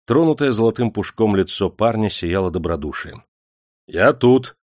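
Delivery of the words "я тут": loud, high, bold answer; fearless reply. A narrator's reading. Sturdy lad answers the clerk, fearlessly. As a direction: speak up without fear. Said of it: "бесстрашно отозвался крепыш".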